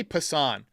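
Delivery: Passan stressed on a drawn-out last syllable; mispronounced Passan. Real, not acted. Streamer puzzled by his own habit, Passan stressed on the end. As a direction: say Passan as "puh-SAHN". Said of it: "Why do I always say Passan?".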